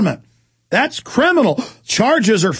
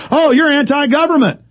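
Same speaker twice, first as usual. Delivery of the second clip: high, loud sneering mock of the accusers; mocking accusation; 8 semitones higher, 4 dB louder than usual. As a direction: throw the label back in a shrill taunt.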